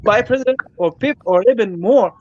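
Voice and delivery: normal talking voice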